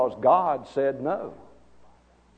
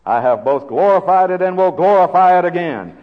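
louder, higher proclamation as God; voice from heaven; 6 semitones higher, 10 dB louder than usual. Retold or imitated. Preacher quotes God's answer from heaven, booming proclamation. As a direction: boom it out as a heavenly voice. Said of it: "a voice came from heaven".

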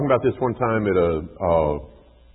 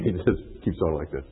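ordinary talk

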